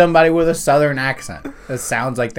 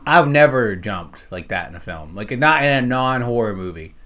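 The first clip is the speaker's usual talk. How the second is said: ordinary talk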